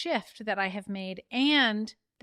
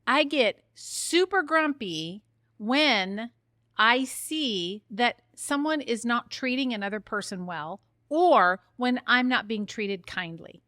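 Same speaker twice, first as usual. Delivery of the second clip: ordinary delivery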